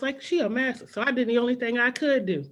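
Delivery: normal talking voice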